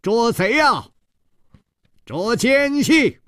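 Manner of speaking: very high, drawn-out alarm cry; long, soaring shout for help; loud calling out. A narrator's reading. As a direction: raise the alarm for the whole house. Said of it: "大声地呼喊道".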